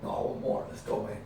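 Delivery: whispered push to keep going; low determined whisper; hushed eager insistence. Retold or imitated. He insists on continuing.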